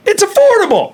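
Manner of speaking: very high, falsetto-like catchphrase; high-pitched squeal. Pretend. Host plugging the doctor's prices, high-pitched squeal.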